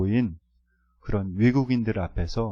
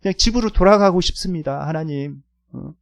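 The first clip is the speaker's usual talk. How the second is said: higher, pleading cry to God, half acted; crying-out prayer; 7 semitones higher, 3 dB louder than usual. Student praying on the field the night before, a retold pleading cry. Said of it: "막 부르짖으면서, 어, 이렇게 기도했습니다".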